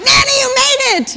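high, loud mock celebration; mock cheering. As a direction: cheer her arrival as a big feat. Cheering grandmother's slow arrival at door.

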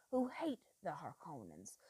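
intense whisper. A narrator's reading. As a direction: hiss it like a secret. Yueh describes Harkonnen haters.

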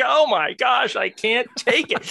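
high-pitched mock exasperation; exasperated wife. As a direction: imitate someone who can't stand the sound. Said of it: "it's like nails on blackboards".

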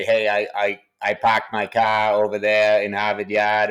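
a light, hammed-up Boston accent with dropped R's; mock Boston accent. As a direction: play at a Matt Damon style Boston drawl, half-joking. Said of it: "a Matt Damon, Ben Affleck drawl".

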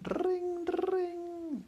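very high sung ring ring; high-pitched telephone ring imitation; phone ring. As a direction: chime it like a phone bell. Phone ringing in story.

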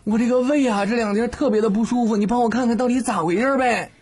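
complaining patient. Retold or imitated. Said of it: "问医生".

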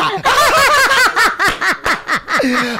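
loud, high shrieking laughter; real belly laugh. Real, not acted. Friends cracking up over a flirting joke, real shrieking laughter. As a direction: burst out cackling helplessly.

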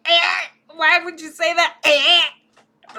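high loud hairball choking. Acted. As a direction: hack it out mid-hairball. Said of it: "choke on a hairball".

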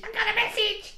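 very high-pitched excited squeal. Real, not acted. Announcing a friend's message has arrived, excited squeal. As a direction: squeak it out in delight.

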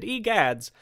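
high, mild exclamation; startled shout. Acted. Man flinches at Santa's hollow tongue, startled cry. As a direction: flinch and cry out, pitch jumping up. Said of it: "Andrew flinched and shouted".

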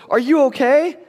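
high, loud doting sister voice; older sister fussing over her rescued brother; imitating big sister. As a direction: gush like a caring big sister. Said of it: "if she says".

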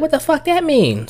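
high-pitched mock indignation; mimicked offended woman. Woman challenges his single remark, mock indignant high voice. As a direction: imitate her affronted question up high.

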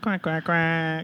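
slow quacking like a duck; animal chorus. Acted. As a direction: quack it out slowly as the hype guy. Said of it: "can you be the hype guy".

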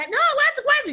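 lightly mocked gringa, pitched high; imitated gringa. Gringa questioning a traveler at airport security, imitated high.

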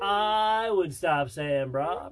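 high slow surfer dude drawl; frat bro. Pretend. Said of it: "Hey, brah, look here, brah".

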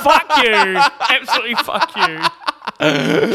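drawn-out laughing curse; laughing mock insult. Real, not acted.

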